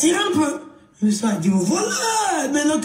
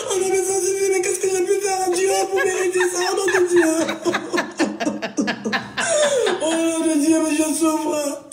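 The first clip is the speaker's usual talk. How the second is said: higher, mock lament to God; hammed-up wailing; 5 semitones higher than usual. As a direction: cry out to the heavens in fake despair.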